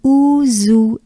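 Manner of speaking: Ouzoue as "ou-zou-é", parts separate; unlinked syllables. Shown as a demonstration. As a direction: Ouzoue with é set apart. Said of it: "let's start like this".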